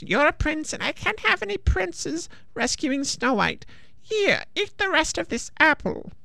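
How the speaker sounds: put-on very high, croaky deaf old witch; croaking witch. A narrator's reading. Second witch continues.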